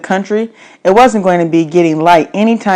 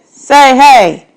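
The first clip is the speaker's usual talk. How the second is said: loud call into the dark, pitch raised; 7 semitones higher, 6 dB louder than usual. Acted. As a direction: call out to find the hiding boy. Man calls out to hidden boy.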